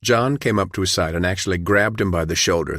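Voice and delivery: usual speaking voice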